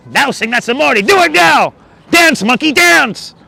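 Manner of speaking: put-on loud, high, over-the-top accented demand; climbing into a mock shout; hammed-up barked command. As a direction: order the performer about at full tilt. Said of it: "No more accents".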